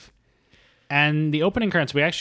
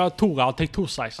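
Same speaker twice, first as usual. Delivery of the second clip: put-on Brooklyn accent; 5 semitones higher than usual. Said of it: "He's doing a very thick Brooklyn accent".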